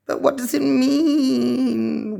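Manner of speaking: put-on a worried little boy near tears; wailing. A narrator's reading. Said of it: "wailed Roosevelt".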